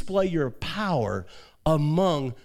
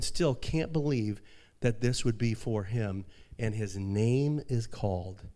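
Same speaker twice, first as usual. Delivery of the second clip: ordinary talk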